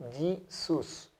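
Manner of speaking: Jesús said yisus, with a y; iota as y. Shown as a demonstration. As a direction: say Jesús as yee-soos. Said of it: "suena Y". (Jesús dicho "yisus", con y)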